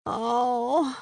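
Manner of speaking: drawn-out groan of pain; groaning as she lies back. A narrator's reading. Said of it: "I groaned".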